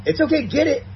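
high, sing-song coaxing to a dog; encouraging a dog that dropped its toy, high coaxing. Shown as a demonstration. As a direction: coax the dog, lifting way up. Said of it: "I usually start by".

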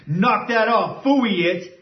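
high harsh scolding of the dog; harsh correction. Shown as a demonstration. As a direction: snap a sharp correction at the dog. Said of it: "is harsh in my tone".

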